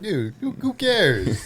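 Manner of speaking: high, loud, slow who cares; brushing off a correction in a high, slow whine; dismissive. Retold or imitated. Retold shrug-off to a corrector.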